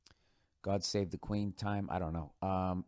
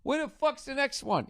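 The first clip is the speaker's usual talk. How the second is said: impatient binge-watching fan. Retold or imitated. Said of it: "And then be like".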